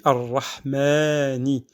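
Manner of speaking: Ar-Rahmani chanted with a drawn-out aa; lengthened madd. Shown as a demonstration. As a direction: hold the long a in Ar-Rahmani. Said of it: "c'est de, d'étendre un petit peu le souffle ou le son".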